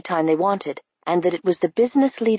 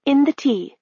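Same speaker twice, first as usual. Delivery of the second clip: gasping; 7 semitones higher than usual. A narrator's reading. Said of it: "he gasped".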